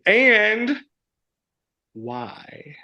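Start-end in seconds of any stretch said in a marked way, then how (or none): 1.9-2.8 a breathy whispered why. Acted. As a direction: drop to a secret whisper on why. Whispered question in game.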